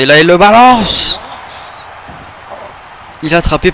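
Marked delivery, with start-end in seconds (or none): 0.0-1.2 loud, slow excited play-by-play; excited call. Real, not acted. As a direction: belt it out as the slam lands. Commentator calling a slam.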